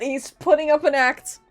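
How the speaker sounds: ordinary talk, nothing marked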